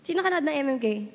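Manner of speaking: ordinary talk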